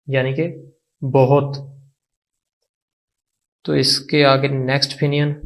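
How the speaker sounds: ordinary talk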